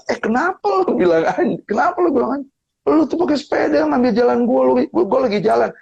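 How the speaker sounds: high, heated retort at a rude cyclist; indignant retort. Retold or imitated. Retelling his reply to the cyclist who swore, heated and high. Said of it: "Terus saya bilang".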